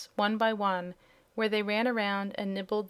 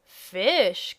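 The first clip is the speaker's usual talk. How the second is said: loud, eager exclaim; excited cry; 7 dB louder than usual. Acted. Hungry kitten cries out eagerly at the mention of fish. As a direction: pounce on the word with delight. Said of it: "cried the kitten".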